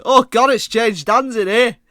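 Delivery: loud, high, slow mock English accent; exaggerated English-accented dismay. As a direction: imitate horrified relatives in a booming English accent. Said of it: "they said it exactly like this".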